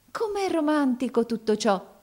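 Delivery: high, excited exclamation; exclaiming. A narrator's reading. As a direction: gush over it like a dreamy girl. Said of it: "esclamò essa".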